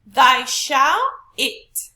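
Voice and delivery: THY, SHALL and IT each drawn out and loud; stressed syllables. Shown as a demonstration. Teacher testing which syllables carry stress, overstressed. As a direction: punch "Thy shall it" slowly, every word heavy. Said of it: "we look at the capital letters".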